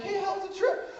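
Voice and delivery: high, sing-out greeting; chirpy mom. Acted. Mom greeting son back from trip, chirpy. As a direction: ask it high and breezily.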